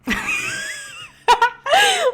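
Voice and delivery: high shrieking burst of laughter. Real, not acted. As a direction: let out a piercing laugh.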